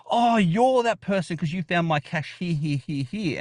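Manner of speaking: high-pitched, thrilled realization; excited recognition. Retold or imitated. Geocacher recognizing who found his cache, excited. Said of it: "And I was excited".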